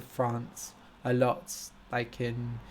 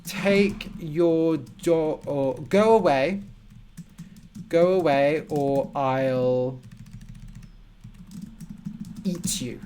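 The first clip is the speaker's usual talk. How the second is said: slow threat; slower than usual. Shown as a demonstration. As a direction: warn them off, slowly and deliberately. Teacher gives example of a threat.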